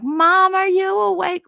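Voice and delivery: high, loud call of "Mom, are you awake?"; yelling kid. Retold or imitated. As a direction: shout it right into mom's face. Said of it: "yelling in my eye".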